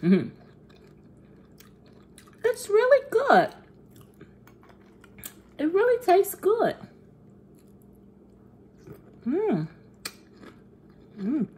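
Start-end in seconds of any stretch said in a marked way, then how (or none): 2.4-6.7 put-on very high childlike voice; seven-year-old self. Tasting cereal for viewers, pretend seven-year-old voice. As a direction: review it as a little kid, pitched way up. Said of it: "transitioning to my seven-year-old self".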